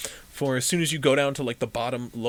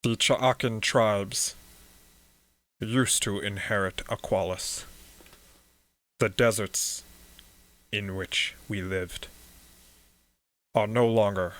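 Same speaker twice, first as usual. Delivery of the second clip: lightly voiced monotone priest; 3 semitones lower than usual. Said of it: "He speaks in this monotone voice".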